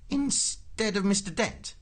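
high, slow disbelief; incredulous official. A narrator's reading. Prosser checks he replaces Mister Dent, slow high disbelief. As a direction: draw the question out, pitched high. Prosser queries Ford's absurd request.

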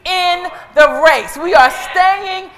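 loud, rousing call to the congregation; impassioned pulpit refrain; full-force preaching. Real, not acted. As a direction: cry it out, stretching the key words.

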